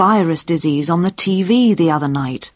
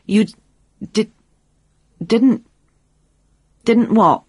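halting, higher stammer with long gaps; 4 semitones higher than usual. A narrator's reading. Pete struggling to tell Jane on the phone, stammering. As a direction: stumble over the words, stopping and restarting. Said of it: "Then he stammered".

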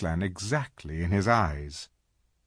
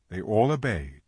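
ordinary delivery

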